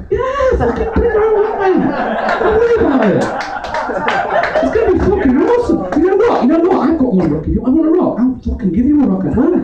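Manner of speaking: high, eager, laughing mate on the phone; excited mate. Retold or imitated. Mate on the phone urges his friend along, high excited laughing. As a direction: hype it up like a mate keen for a stoning.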